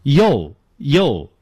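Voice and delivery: "yo，yo" with an open o in place of oo; wrong U as yo. Shown as a demonstration. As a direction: mispronounce U as short "yo". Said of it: "错误地发成".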